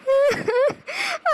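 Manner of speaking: high theatrical wail of grief; wailing cry. Acted. Mother wails over daughter's marriage. (ஒப்பாரி அழுகை)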